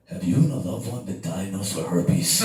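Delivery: lightly mimicked commercial voice-over. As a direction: read it like a mock pharmaceutical ad. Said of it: "You know what the first ad was?".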